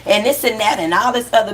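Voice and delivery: squealed high mocking Ooh then sneering chatter; mocking gossip voice. Imitating trolls who judge her online, in a squealing mocking voice. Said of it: "people be like".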